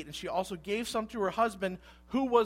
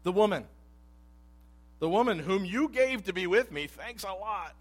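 sarcastic blaming; 4 semitones higher, 6 dB louder than usual. Retold or imitated. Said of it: "What did the man say?".

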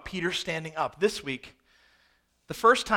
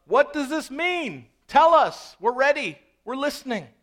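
high, loud calls from the crowd; shouting up; 7 semitones higher, 7 dB louder than usual. Acted. Onlooker shouts up to Peter's circle, insistent high call. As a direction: shout up for an answer, easing off at the end. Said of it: "someone shouts up".

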